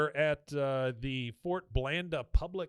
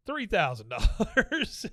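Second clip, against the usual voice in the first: smug bid; 7 semitones higher, 5 dB louder, slower than usual. Retold or imitated. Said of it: "the Kitten guy goes".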